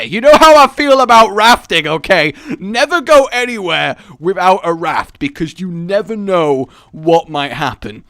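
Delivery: loud, high, emphatic proclamation; hammed-up fervent declaration; impassioned mock rant. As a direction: preach it as a vital rule. Mock-passionate rant about rafting.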